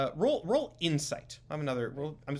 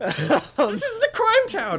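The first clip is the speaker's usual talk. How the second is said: much higher, slow, laughing through it; 7 dB louder, much slower than usual. Acted. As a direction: laugh out the line in character. GM laughing in character.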